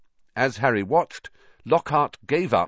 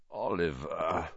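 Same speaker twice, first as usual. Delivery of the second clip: softer, croaking just-woken voice; croaky; 3 semitones lower, 7 dB quieter, slower than usual. A narrator's reading. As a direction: croak it out half asleep. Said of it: "Harry croaked".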